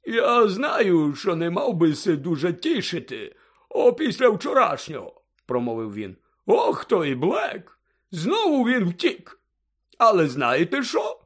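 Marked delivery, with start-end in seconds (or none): 0.0-5.2 put-on high, loud, jolly drunken figure; high-pitched tipsy storybook voice; tipsy Hagrid. A narrator's reading. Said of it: "захмелілого Гегріда". 6.5-11.2 put-on high, slow drunken exclaiming; boozy, excited storybook voice, high and slow; tipsy Hagrid. A narrator's reading. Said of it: "захмелілого Гегріда".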